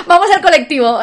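mock Argentine slang with a Spanish accent; Argentine phrase in Spanish accent. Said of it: "todo esto con acento español". (frase argentina con acento castellano, más aguda y fuerte)

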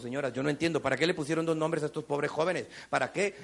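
normal talking voice

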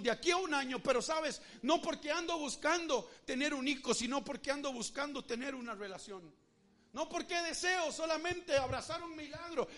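loud, emphatic promise of God; booming divine promise. Acted. God answering the doubting believer, loud and emphatic. God's reply voiced, louder.

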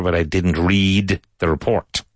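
"read" held long and slow; slow model sentence, leaning on "read"; stress on read. Shown as a demonstration. Teacher models for students, slow and clear. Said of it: "I read the letter".

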